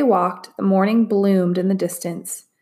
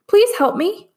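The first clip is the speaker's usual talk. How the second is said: pleading; 13 semitones higher, 4 dB louder than usual. A narrator's reading. Trapped voice pleading.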